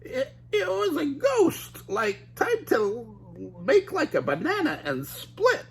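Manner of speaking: high slow terrified Shaggy with a laugh; high scared Shaggy, nervous laugh; Shaggy crying out. Acted. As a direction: panic and flee. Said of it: "cried Shaggy".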